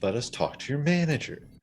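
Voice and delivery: Karen voice. Pretend. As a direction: demand the manager like a Karen. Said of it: "We gotta be Karens about this".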